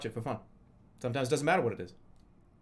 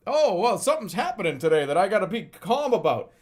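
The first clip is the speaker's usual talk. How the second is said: mock breezy; 4 semitones higher, 6 dB louder than usual. Mocking a relaxed attitude.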